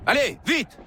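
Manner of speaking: barking French commands to the crew, fast; captain yelling orders across the deck; shouted orders. Acted.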